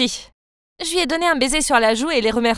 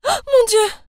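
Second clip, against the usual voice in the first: high startled scream of fright; shocked cry; 11 semitones higher, 3 dB louder, slower than usual. Acted. Girl startled by a man under the desk, high shocked cry. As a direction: cry out at the sudden intruder. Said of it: "J'ai crié".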